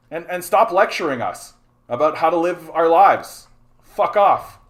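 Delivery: loud, fed-up outburst; angry telling-off. Real, not acted. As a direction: tell them off at full voice. Telling off the commenter.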